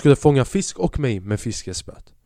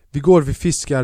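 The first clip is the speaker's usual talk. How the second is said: normal talking voice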